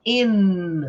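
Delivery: "in" with a held N, mouth open; long "in" ending on N, never M; open final N. Shown as a demonstration. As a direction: sustain the N of "in" without closing the lips. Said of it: "e não fecha a boca".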